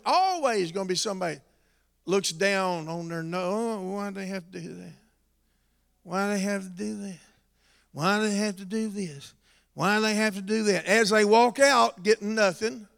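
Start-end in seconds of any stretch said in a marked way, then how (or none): 3.5-10.9 very soft, quick disapproving mumble; mock complaining. People looking down their noses, quiet mock complaining. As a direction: imitate a snooty complainer under the breath.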